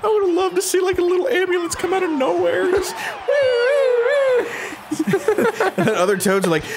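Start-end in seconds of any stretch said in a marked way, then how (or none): 0.0-5.8 high squeal through laughter; squeaky, giggling disbelief; helpless laughter. Real, not acted. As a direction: talk while cracking up, pitch way up. Cracking up over killed Toad.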